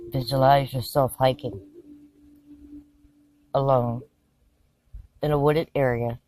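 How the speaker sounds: hushed, measured meditation voice; calm narration with lots of space between phrases; guided visualization. Acted. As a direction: guide them through the scene phrase by phrase, leaving long pauses.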